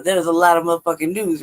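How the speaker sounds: usual speaking voice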